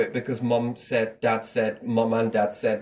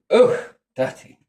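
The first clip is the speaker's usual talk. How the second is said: ugh, dirty spat out higher, louder and slow; mock disgust; 7 semitones higher, 8 dB louder, slower than usual. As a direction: sneer like a snob looking down on someone. Mock disgust at lower people.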